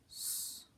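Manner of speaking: S as a lone, voiceless hiss; sounding out. Shown as a demonstration. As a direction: say only the sound of S, drawn out. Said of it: "sounding out each sound".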